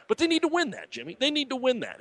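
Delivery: high-pitched, emphatic NEED TO WIN THAT, falling at the end; emphatic insistence. Real, not acted. Host telling Jimmy they must win, NEED TO WIN THAT high and insistent.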